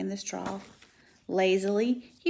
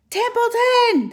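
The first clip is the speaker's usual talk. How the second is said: very high, loud, drawn-out scream; 11 dB louder, slower than usual. Acted. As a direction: scream the rat awake. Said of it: "screamed Wilbur".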